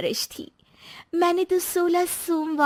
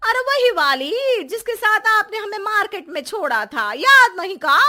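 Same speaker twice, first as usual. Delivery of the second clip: higher, louder nagging pitch; scolding wife; 6 semitones higher, 6 dB louder, faster than usual. Acted. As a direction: scold him for forgetting. Wife scolding forgetful husband. (पति को डाँटती हुई, ऊँची और तेज़ आवाज़ में)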